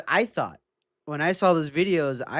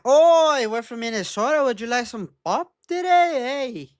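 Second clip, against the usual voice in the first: sing-song Midwest voice, a bit hammed; Minnesota accent; 6 semitones higher than usual. Mimics Minnesota girl.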